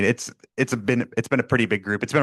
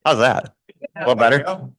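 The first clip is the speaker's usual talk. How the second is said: louder teacher voice for the room; loud sharp voice; 4 semitones higher, 5 dB louder than usual. Real, not acted. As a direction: project it like a teacher. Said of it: "I was trying to use my teacher voice".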